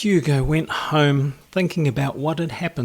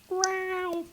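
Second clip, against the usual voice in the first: tiny high squeak of a growl; a baby growl, very high and soft; squeaky little growl; 6 dB quieter than usual. A narrator's reading. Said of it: "a very squeaky little growl".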